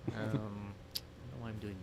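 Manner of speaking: ordinary delivery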